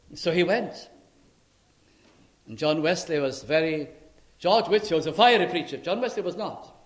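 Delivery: ordinary talk, nothing marked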